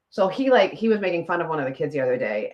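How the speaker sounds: usual speaking voice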